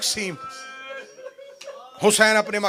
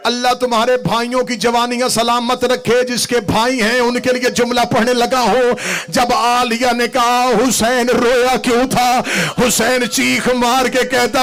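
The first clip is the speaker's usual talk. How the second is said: loud, impassioned majlis preaching; fervent sermon delivery, raised voice; full-force preaching; 5 semitones higher, 5 dB louder than usual. Acted. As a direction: preach it out over the crowd.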